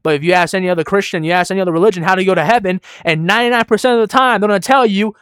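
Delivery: high, loud, rapid preaching; forceful preaching. Real, not acted. Preacher warning listeners about other religions' answers, forceful preaching. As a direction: press the point home from the pulpit.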